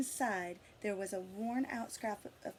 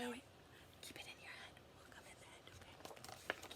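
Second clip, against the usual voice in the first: whisper; 17 dB quieter, much faster than usual. Real, not acted. Teacher telling a child to keep the answer in their head, whispered. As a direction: hush them gently, keep it secret.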